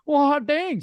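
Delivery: high, loud hammed-up wonder; acted amazement. Acts out own delighted discovery.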